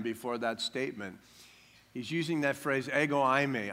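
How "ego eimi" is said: ordinary talk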